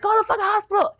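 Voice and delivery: high, urgent shouted command; frantic order. Retold or imitated. Wife orders the brother-in-law to the hospital, high frantic yell. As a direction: yell at him to drive to the hospital. Yelling again, cussing.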